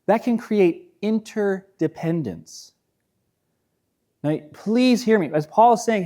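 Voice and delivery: usual speaking voice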